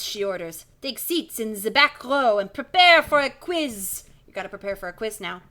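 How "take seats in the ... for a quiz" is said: put-on strict teacher with a French accent, louder; stern French schoolmistress greeting the class. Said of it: "She's French as well".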